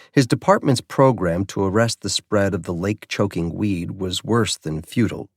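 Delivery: ordinary delivery, nothing marked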